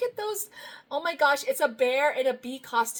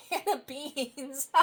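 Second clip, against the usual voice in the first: ordinary talk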